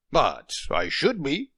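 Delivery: half-restrained husbandly growl. A narrator's reading. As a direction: grumble it out like a stubborn husband. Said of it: "he growled".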